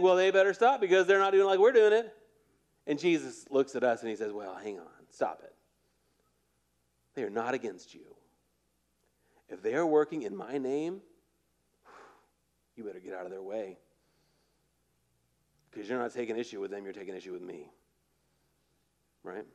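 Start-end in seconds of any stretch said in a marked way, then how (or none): 0.0-2.2 higher, louder, mock indignation; smug church voice. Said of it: "And we think". 4.3-17.7 softer, calm, firm correction; Jesus quietly setting them straight; gentle Jesus. Acted. Jesus voiced in sermon.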